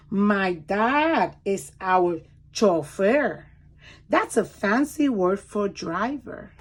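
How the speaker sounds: lively storybook read-aloud; reading to children. Acted. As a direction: read it like a picture book for small kids. Reads picture book aloud.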